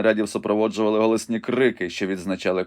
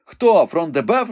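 high, loud eager shout; shouted question; 8 semitones higher, 7 dB louder, slower than usual. A narrator's reading. Ivanhoe shouts to Rebecca to learn who fell, loud. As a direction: cry out the name. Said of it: "закричав Айвенго".

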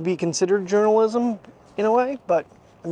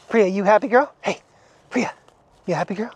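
normal talking voice

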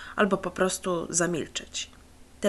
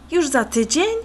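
high, louder dismayed question; a hint of disappointment in the boy; disappointed; 6 semitones higher, 6 dB louder than usual. A narrator's reading. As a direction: let the letdown show. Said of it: "zapytał zawiedziony".

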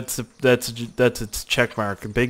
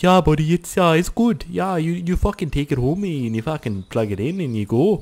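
hammed-up Canadian accent, pitched up at first; 4 semitones higher than usual. Store guy telling me to plug it in, mock Canadian accent. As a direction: do a bad Canadian buddy. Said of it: "but he was Canadian".

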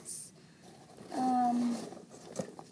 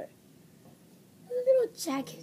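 much higher, slow coo; 11 semitones higher, much slower than usual. Real, not acted. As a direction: coo it like a treasure. Finds the jacket.

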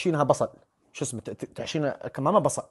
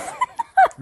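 high squealing laugh; 6 dB louder than usual. Real, not acted. Laughing at her joke.

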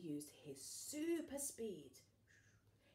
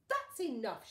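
loud stern shout; heroic shout; 4 semitones higher, 10 dB louder than usual. Acted. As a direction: stop the villain with a shout. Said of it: "shouted Sue Potato".

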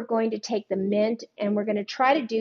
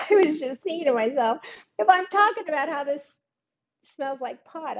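talking through real laughter, a bit higher; laughing; 6 semitones higher than usual. Real, not acted. Telling viewers her joke while still laughing. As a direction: let the giggles spill into the words.

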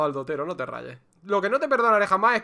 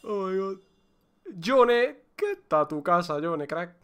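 ordinary talk

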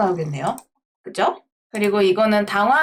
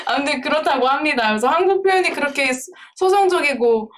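ordinary delivery

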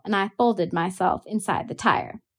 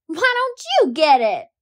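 higher, louder defiant scream; screamed back; 8 semitones higher, 5 dB louder than usual. A narrator's reading. Girl screaming back at her brother, high and loud. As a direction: scream it right back at him. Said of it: "I screamed".

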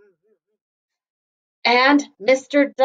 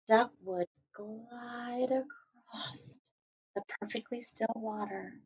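hushed glide; 16 dB quieter than usual. A narrator's reading. Telling kids about the morning glide, a hushed whisper. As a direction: breathe it out softly like still water.

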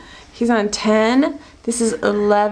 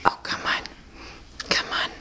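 whispering; 9 dB quieter, faster than usual. Real, not acted. Quiet coaxing.

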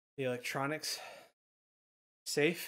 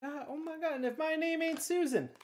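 thrilled exclamation; 12 semitones higher, 4 dB louder than usual. Real, not acted. Final exclamation of amazement.

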